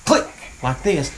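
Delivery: ordinary talk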